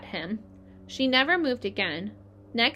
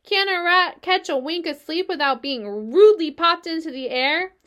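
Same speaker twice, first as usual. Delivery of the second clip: grumpy rat; 7 semitones higher, 6 dB louder than usual. A narrator's reading. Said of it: "he growled".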